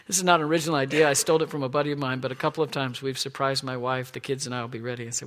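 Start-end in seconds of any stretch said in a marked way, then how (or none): none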